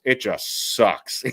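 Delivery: slow, drawn-out mimic of a glum colleague; slow imitation of Schmeelk. Imitating a glum colleague, slow and drawn out. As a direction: drag out the words like a deflated analyst. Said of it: "Schmeelk came out and goes".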